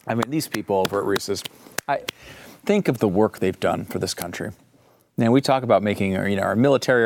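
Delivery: mock solemn. Real, not acted. Mock solemn tribute to Reese's.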